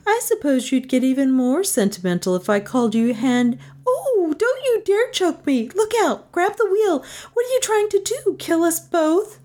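teasing voice leaping to high alarm; alarmed Melissa. Acted. Passenger seeing the car swerve, high alarm. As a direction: climb from teasing into very high-pitched panic.

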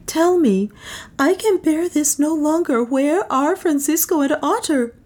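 high, urgent woman's voice; pleading heroine. A narrator's reading. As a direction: beg for the truth, pitched high. Voices heroine demanding news.

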